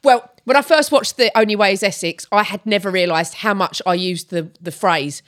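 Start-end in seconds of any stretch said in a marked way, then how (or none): none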